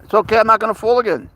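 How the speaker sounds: high, quick reassurance to a startled cat; soothing a scared pet. Real, not acted. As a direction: apologize gently to the cat. Apologizing to cat after fall.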